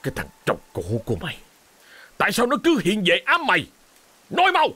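growled, building to a shout; furious husband pressing hard; harsh interrogation. Acted. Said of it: "Hội đồng bính gằn giọng".

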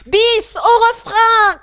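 very loud high cry for an encore; shouting. Acted. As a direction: shout for the chorus again. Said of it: "cria le griffon".

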